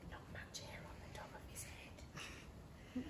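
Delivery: cheeky whispered aside; hushed giggly aside. Acted. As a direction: whisper the joke behind his back. Whispered joke about dad.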